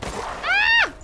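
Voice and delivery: very high sudden scream of alarm; short high shriek of panic; panicked yelp. Real, not acted. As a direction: cry out as the enemy lunges.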